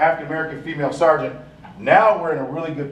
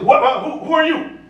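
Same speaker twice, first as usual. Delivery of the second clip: much higher, much louder shout; sergeant yelling; 12 semitones higher, 5 dB louder than usual. Retold or imitated. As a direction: bark the question at an intruder. Sergeant snaps at narrator.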